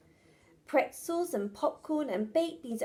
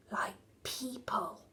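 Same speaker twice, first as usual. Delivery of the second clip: menacing whisper; 3 semitones lower, 9 dB quieter than usual. Acted. Dragon's menacing aside.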